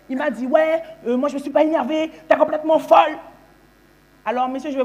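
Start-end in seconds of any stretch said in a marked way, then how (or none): 0.5-3.2 higher-pitched mock-annoyed boyfriend; acted huffy denial, getting louder; irritated retort. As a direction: rise into an exasperated accusation.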